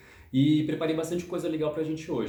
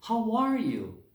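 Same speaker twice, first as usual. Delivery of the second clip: bright model; 10 semitones higher, much slower than usual. Shown as a demonstration. Teacher prompting class repetition with a high, bright "how are you". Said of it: "Repeat after me".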